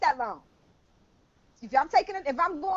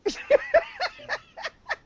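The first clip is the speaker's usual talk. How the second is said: high squealing laugh. Real, not acted. Laugh after acted quote.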